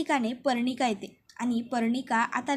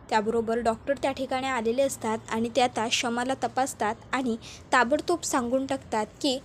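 ordinary delivery

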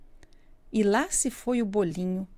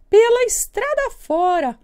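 very high squeaky bun voice; little bun voice; 9 semitones higher, 7 dB louder than usual. Acted. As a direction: squeal like a tiny frightened bun. Bolinho responde à raposa. (voz bem aguda de bolinho)

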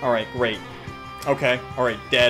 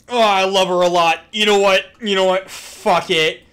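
loud, raised-pitch fan enthusiasm; loud gushing; 6 semitones higher, 8 dB louder than usual. Real, not acted. Streamer raving about a favorite character, loud gushing. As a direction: gush at full volume.

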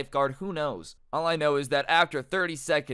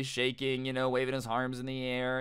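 ordinary delivery

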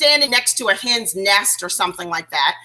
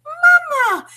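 put-on baby chick. Retelling a cartoon to agents, voicing the chick's very high cry. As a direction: squeal it up high like a newborn chick calling. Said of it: "the chick says".

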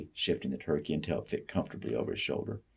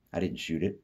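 plain reading voice

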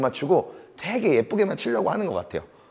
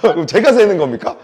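raised, higher-pitched playful protest; mock indignation; 5 semitones higher, 8 dB louder than usual. Coach ribbing the student who asked who counts, mock-indignant. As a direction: tease with exaggerated disbelief.

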